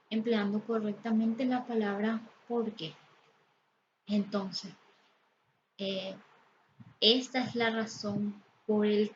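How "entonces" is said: ordinary talk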